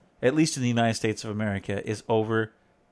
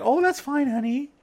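high, sweet mock-wife voice; imitating mellow wife; 13 semitones higher than usual. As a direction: lilt it high like an easygoing mom. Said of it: "She'd be like".

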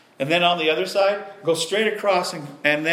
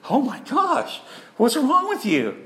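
higher, startled doctor asking what's wrong; doctor shocked at how the patient looks; shocked concern; 6 semitones higher, slower than usual. Retold or imitated. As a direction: voice her alarm at the sight of him. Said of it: "and she goes".

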